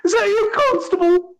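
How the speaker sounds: very high, loud voice calling down from far off; distant echoing Lionel. A narrator's reading. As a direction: call down from the top of a bell tower. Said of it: "his voice kind of echoing".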